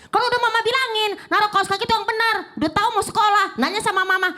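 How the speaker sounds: scolding mother. Retold or imitated. Mother scolding child about socks.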